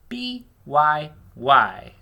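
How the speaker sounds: loud, slow mistaken spelling; a teacher's loud slip while spelling aloud; misread spelling. Shown as a demonstration. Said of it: "I read the word wrong".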